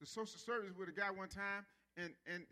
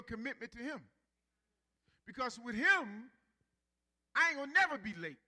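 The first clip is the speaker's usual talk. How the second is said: full-force preaching; 2 semitones higher, 8 dB louder than usual. Real, not acted. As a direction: drive it home to the congregation.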